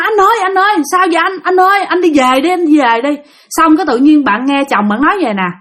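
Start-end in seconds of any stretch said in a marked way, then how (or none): 0.0-3.1 higher-pitched acted wife calling her husband; anxious pleading. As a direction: urge him home, worried. Wife anxiously calls husband home. (giọng vợ lo lắng gọi chồng)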